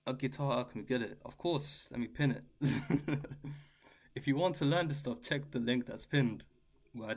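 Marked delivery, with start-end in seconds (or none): 2.5-3.6 short real chuckle; brief spontaneous laugh; amused laugh. Real, not acted. Streamer to viewers while pinning a link, amused laugh.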